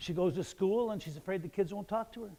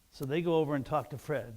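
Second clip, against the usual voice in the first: ordinary delivery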